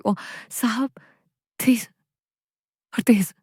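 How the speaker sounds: moaning. A narrator's reading. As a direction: moan it with eyes closed. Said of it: "मैं आंखें बंद किए करा रही थी".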